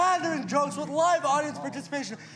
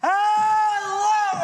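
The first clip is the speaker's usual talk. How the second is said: loud, higher hello to the crowd; loud greeting; 5 semitones higher, 6 dB louder than usual. Real, not acted. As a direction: call out to the audience. Performer greeting audience.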